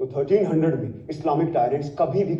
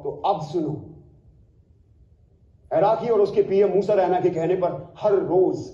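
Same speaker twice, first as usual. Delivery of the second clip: ordinary delivery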